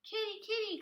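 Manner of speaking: put-on high, loud child's calling voice; calling a cat. A narrator's reading. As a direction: call out for the lost cat. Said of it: "called a little girl".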